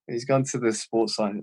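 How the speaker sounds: usual speaking voice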